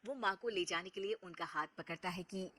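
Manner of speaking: usual speaking voice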